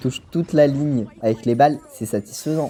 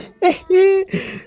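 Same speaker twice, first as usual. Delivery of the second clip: sudden squealing laugh, up high; high burst of laughter; 4 dB louder than usual. Real, not acted. A gamer laughing at his own bad English, high squeal. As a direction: crack up at yourself in a shrill burst.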